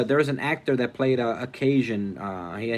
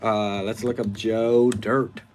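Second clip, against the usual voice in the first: ordinary talk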